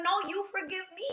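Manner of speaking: retold tearful reply. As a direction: imitate a mother tearfully handing the apology back. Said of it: "And she started crying".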